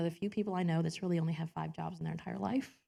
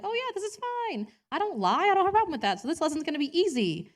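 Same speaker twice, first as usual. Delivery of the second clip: smug past self; 9 semitones higher, faster than usual. Retold or imitated. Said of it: "I'm like".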